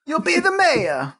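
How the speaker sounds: high, squeaky Mario-style voice; cartoon Italian voice. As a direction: play it like a cartoon plumber. Co-host joins cartoon voice.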